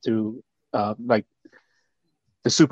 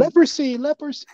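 high mock yell of leprosy; mock drunk yelling. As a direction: squeal it like a drunk friend. Teasing friend about drunk yelling.

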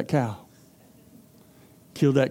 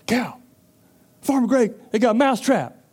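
higher, urgent warning; mouse warning the cow; 7 semitones higher than usual. Acted. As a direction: plead with the cow.

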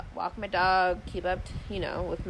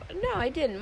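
pointed retort; 9 semitones higher than usual. Retold or imitated. Sharp retort in retold call.

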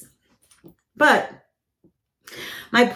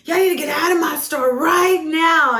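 yelling; 9 semitones higher than usual. Retold or imitated. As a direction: bark the order at the whole store. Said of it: "like, yelling".

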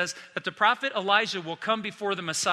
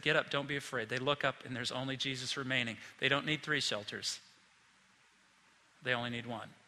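soft tender; 5 semitones lower, 7 dB quieter than usual. Real, not acted. Soft tender close of point.

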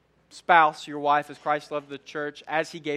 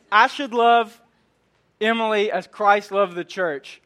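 louder, higher proud declaration; proud loud answer; 4 semitones higher, 7 dB louder than usual. Retold or imitated. Husband answering counselor, proudly loud and high. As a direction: belt out the right answer like a winner.

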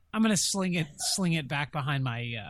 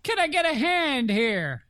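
slow, loud, high yell to the room; 10 semitones higher, 7 dB louder than usual. Acted. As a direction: shout it out, drawn out. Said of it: "I wanna yell".

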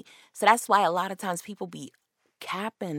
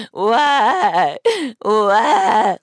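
mock crying; 3 semitones higher, 8 dB louder than usual. Woman on ground, mocked crying.

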